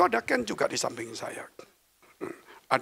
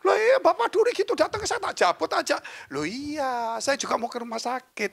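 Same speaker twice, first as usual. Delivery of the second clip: very high-pitched mock bickering; animated protest; 12 semitones higher than usual. Pastor and doctor friend argue over the fishbone, high-pitched protest. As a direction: squeal out an indignant retort in acted dialogue.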